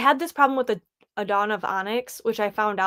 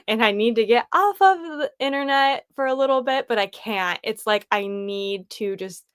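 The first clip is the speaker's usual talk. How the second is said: ordinary talk, nothing marked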